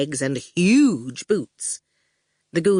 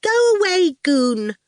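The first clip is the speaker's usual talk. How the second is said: put-on high, pert child voice; bossy little girl; 12 semitones higher, 4 dB louder than usual. A narrator's reading. Little sister orders the goon out, high and bossy. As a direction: boss him off in a high voice.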